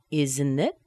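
"Isn't it" linked, rising at the end; rising intonation. Shown as a demonstration. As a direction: let "it" swing up as a question. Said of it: "The T disappears because of the vowel".

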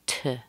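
"to" as a short soft tuh; reduced. Shown as a demonstration. Demonstrates reduced structure word.

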